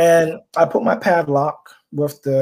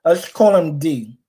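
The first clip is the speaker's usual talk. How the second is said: usual speaking voice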